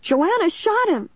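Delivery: high, drawn-out distraught cry. A narrator's reading. Caitlin accusing Joanna before police, slow high wail. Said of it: "Caitlin cried".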